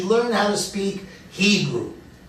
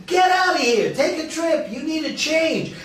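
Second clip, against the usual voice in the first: higher-pitched, insistent urging; 9 semitones higher than usual. Retold or imitated. Retelling the tennis buddy's advice to get away, urging. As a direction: imitate a friend pushing for a trip. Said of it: "He's been telling me".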